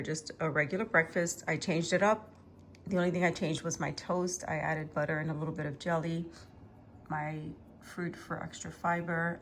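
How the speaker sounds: ordinary talk, nothing marked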